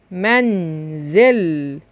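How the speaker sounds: منزل very slowly, holding its consonants; sounded-out L. Shown as a demonstration. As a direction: stretch منزل so the final L is heard. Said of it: "أدي اللام". (نطق منزل ببطء مع إظهار اللام)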